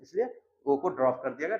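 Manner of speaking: ordinary delivery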